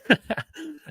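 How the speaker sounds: short high burst of laughter. Real, not acted. Laughing at own joke.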